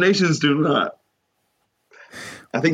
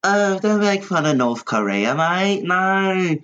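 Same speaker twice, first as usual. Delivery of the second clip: higher mock complaining listener; mocking whiny listener; 8 semitones higher than usual. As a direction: whine like a touchy fan, pitched up. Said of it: "you should see the emails I get".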